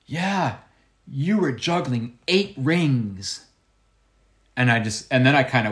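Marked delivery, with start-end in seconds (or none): 0.1-3.4 put-on slow, high old lady voice; old woman. Old fan recognises the juggler's trick, slowly and pitched high. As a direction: imitate an old lady's careful, halting English. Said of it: "And she goes".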